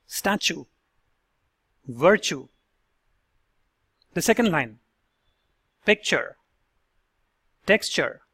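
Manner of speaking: "statue", "virtue", "picture", "texture" with T said as "ch"; careful separated model words; ch-sound model words. Shown as a demonstration. Coach demonstrating T turning into "ch". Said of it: "Let's listen to these words".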